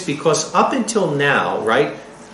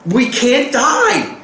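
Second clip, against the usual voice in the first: normal talking voice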